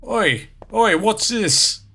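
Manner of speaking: put-on British lad; bad British accent. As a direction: play a nosy British bloke. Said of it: "I'm doing a shitty British accent".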